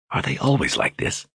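high, soft whisper in his ear. A narrator's reading. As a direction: lean in and whisper it. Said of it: "Keelan whispered in his ear".

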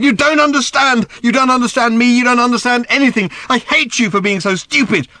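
put-on high, loud, raging teenage girl; furious shouting. A narrator's reading. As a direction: scream it at her father, out of control.

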